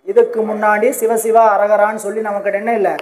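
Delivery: ordinary delivery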